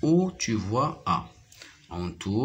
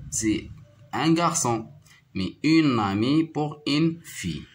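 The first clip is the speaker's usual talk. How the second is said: normal talking voice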